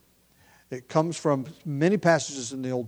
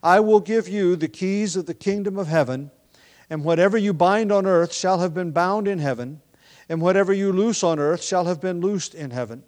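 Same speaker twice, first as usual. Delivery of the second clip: measured, raised reading of the verses; scripture reading; 2 semitones higher, 5 dB louder than usual. Acted. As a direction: read the verses aloud to the congregation. Reads the scripture passage aloud.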